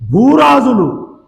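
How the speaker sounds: slow, loud and high on భూరాజులు; loud drawn-out kings. Acted. Preacher reading scripture to the church, loud and drawn out. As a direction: boom out భూరాజులు slowly to the church.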